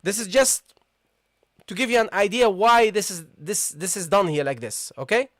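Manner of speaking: ordinary delivery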